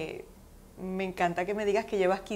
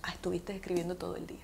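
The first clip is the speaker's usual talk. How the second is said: whispered friend's quip; 8 dB quieter, faster than usual. Retold or imitated. Friend's ironic reply, retold.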